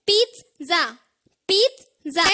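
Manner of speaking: पिज़्ज़ा with a clear "t-s" sound, peet-za; correct pronunciation. Shown as a demonstration. Teacher leading learners in repetition, पिज़्ज़ा split as "peet-za". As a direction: say पिज़्ज़ा in two parts, "peet - za". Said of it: "सही pronunciation सीख जाइए".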